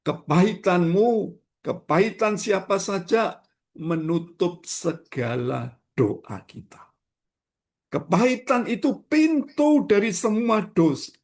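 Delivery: high, loud preacher's cry to the congregation; fiery preaching. Acted. Pastor urges the congregation, preaching at full force. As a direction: lift the voice and preach it hard.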